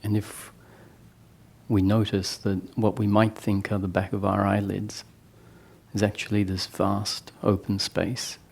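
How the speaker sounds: soft meditation voice with long pauses; guided meditation. Real, not acted. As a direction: guide them gently inward, leaving long silences. Leads eyes-closed guided meditation.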